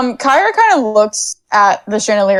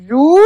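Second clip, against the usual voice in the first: a sliding comic trombone zoop; 3 semitones higher, 4 dB louder than usual. Retold or imitated. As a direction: imitate a sad trombone slide. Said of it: "a trombone noise in the background".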